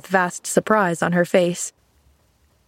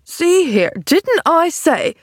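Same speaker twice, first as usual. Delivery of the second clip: higher, slow, gruff outburst; angry; 9 semitones higher, 3 dB louder, slower than usual. A narrator's reading. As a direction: snap at the intruder. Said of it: "began an angry voice".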